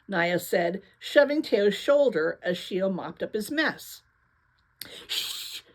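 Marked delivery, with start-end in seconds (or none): 5.1-5.6 unvoiced sharp shush; hissed shush. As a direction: hush them with a hiss. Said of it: "Teo hissed".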